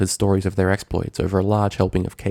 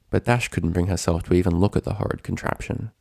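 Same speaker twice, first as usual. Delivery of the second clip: ordinary delivery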